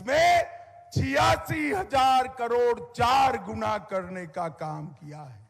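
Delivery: loud emphatic rally oratory; booming political rally speech. Real, not acted. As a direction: drive the numbers home loudly. Rally speech at full force.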